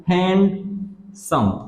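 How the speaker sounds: Handsome stretched slowly as two beats, hand, some; split into syllables. Shown as a demonstration. Teacher showing students two sounds in a word, handsome said syllable by syllable. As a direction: break handsome into two slow, separate syllables. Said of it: "इसके अंदर दो आवाजें हैं".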